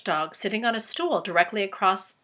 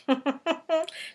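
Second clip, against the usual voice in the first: spider's laugh; 8 semitones higher, 3 dB quieter than usual. Acted.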